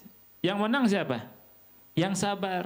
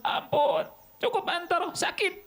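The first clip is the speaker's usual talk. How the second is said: high, pleading cry of pain, much higher; pained plea for mercy; 13 semitones higher than usual. Retold or imitated. Bitten man begs Antaro to let go, high pained plea. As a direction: beg him to stop, it hurts. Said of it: "eh, jerit orang itu. ... Minta ampun".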